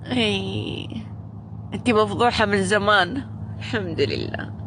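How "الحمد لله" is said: a quiet murmured الحمد لله; soft, low thanks. Real, not acted. Streamer thanking God after viewers' questions, low and soft. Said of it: "يا رب لك الحمد والشكر".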